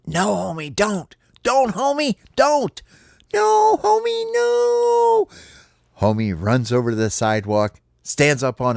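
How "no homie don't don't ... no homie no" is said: very high, rising squeal of protest; squeaky little critters pleading. Acted. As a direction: plead in a tiny cartoon voice, climbing higher. Friends beg homie to stop.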